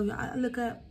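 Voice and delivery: ordinary delivery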